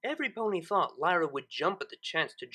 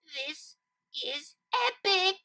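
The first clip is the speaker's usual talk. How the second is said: building exclamation; 11 semitones higher, slower than usual. Acted. Lyra exclaiming word by word.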